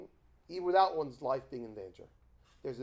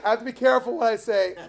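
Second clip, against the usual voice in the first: laughing; 9 semitones higher, 15 dB louder, faster than usual. Real, not acted. Laughing aside about the story.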